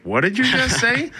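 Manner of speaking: high, incredulous what-did-you-say; baffled what. Retold or imitated. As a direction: sound stunned by the slang. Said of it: "Greg and I are like".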